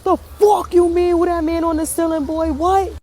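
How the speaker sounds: high-pitched incredulous outburst; alarmed disbelief. Retold or imitated. Parent reacts to man on ceiling, alarmed. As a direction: echo it back in high, panicked disbelief. Alarmed echo of son's question.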